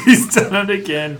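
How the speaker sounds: laughing through the words. Real, not acted. Teasing a friend's clever quiz answer, laughing. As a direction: chuckle out the line, genuinely amused.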